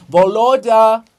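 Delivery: loud shout across the square; a loud summoning shout. Acted. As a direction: call out his name at full voice.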